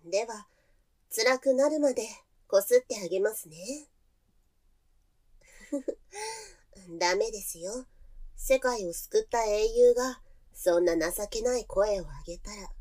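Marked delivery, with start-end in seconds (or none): 5.7-6.4 high, soft little giggle; teasing giggle. Acted. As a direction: giggle sweetly at his suffering. Teasing after threat. (高くくすっと笑う)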